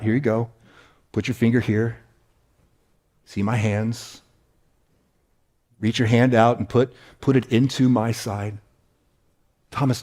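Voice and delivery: soft, low and gentle invitation; gentle Jesus. Retold or imitated. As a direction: hand it over kindly and quietly. Jesus gently invites doubting Thomas.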